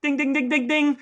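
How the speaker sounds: high sung ding-ding bell; 1 mock bell. Host ringing the prize bell for his brother, high sing-song. Game show correct answer bell.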